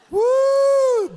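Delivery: high, loud "woo"; showman's crowd-rousing whoop; excited whoop. Real, not acted. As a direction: let out a high held whoop of delight. Whooping over a fish shown.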